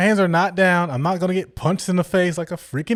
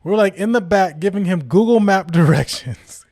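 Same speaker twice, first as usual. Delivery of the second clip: plain reading voice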